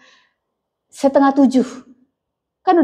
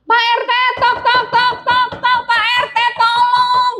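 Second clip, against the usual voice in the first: bang on the door and shout for help; urgent night call for help while knocking; 10 semitones higher than usual. Shown as a demonstration.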